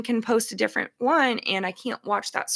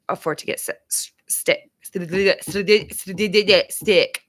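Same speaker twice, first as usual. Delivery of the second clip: tongue-tied stumble; 5 dB louder than usual. Real, not acted. Tongue trips on the word sick.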